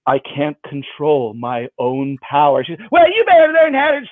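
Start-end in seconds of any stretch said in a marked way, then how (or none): 0.1-2.6 mock mystic. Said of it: "And I go". 2.9-4.1 put-on high, louder bossy little girl; scolding child. Sarah scolds magician.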